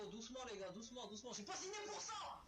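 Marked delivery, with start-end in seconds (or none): 1.3-2.4 panicked protest. Real, not acted. Gamer swarmed by enemies, high panicked protest. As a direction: cry out that this is too much.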